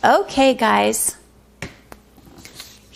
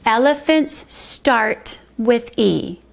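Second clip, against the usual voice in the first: slow reading; slower than usual. Shown as a demonstration. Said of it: "we read it".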